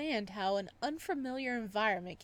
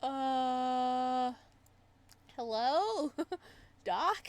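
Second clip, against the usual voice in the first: louder, stretched call into silence; impatient hello; 5 dB louder, much slower than usual. Real, not acted. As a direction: call out to check if anyone's there. Waiting for game response.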